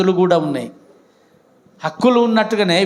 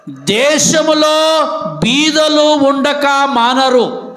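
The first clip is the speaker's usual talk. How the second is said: slow, higher and louder, each word drawn out; emphatic scripture; 8 semitones higher, 5 dB louder, much slower than usual. Acted. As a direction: proclaim దేశములో బీదలు ఉండక మానరు slowly and loud. (నెమ్మదిగా గట్టిగా వాక్యం)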